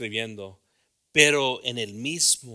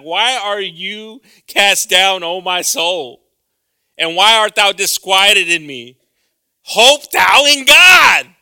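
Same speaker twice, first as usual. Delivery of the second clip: loud, slow, higher proclaimed psalm; solemn scripture reading; 7 semitones higher, 17 dB louder, much slower than usual. Real, not acted. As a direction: declaim the verse to the whole church. Reads Psalm 42 aloud.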